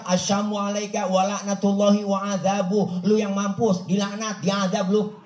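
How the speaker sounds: high, indignant comeback; Aisyah snapping back. Acted. Siti Aisyah throwing the curse back at the unbeliever, indignant. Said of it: "Dibalikin sama Siti Aisyah".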